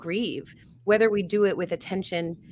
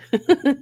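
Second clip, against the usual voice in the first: a short, bright laugh that jumps up loud and high; burst of laughter; 8 semitones higher, 8 dB louder than usual. Real, not acted. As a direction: laugh out at your own line. Laughs at own advice.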